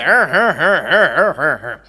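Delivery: repeated rhythmic 'ha' like gear changes, sliding downward; Shatrughan Sinha. Acted. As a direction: mimic a film star revving through the gears. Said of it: "Shatrughan Sinha સાહેબની mimicry".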